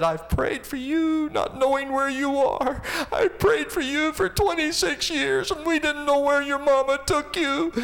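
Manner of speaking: put-on high, loud, crying voice of an old man; weeping grandpa. Grandpa weeping as he hugs his found grandson. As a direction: let the voice break like a weeping grandpa. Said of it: "just heaving as he was weeping".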